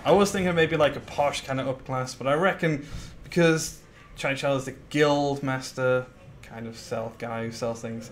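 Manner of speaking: ordinary delivery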